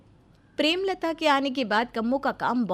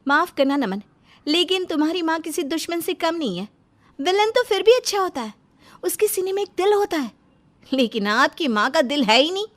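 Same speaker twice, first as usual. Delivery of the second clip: loud, high and cutting complaint; angry retort; 7 semitones higher, 6 dB louder than usual. Acted. Wife hits back at husband about his mother, loud and angry. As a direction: let it build into an angry outburst.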